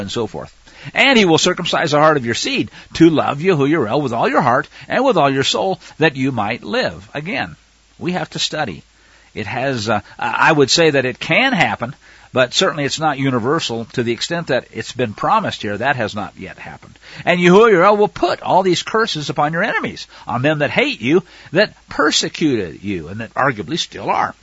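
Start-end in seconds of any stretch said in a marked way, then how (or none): 0.9-7.1 raised, emphatic reading voice; scripture reading. Acted. Preacher reading Deuteronomy aloud, emphatic. 17.3-23.1 raised, emphatic reading voice; scripture reading. Acted. Preacher reading Deuteronomy aloud, emphatic.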